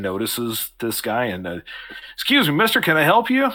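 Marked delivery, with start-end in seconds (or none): 2.1-3.5 put-on high, loud young farmhand calling out; young Danny calling. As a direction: call out brightly to the stranger.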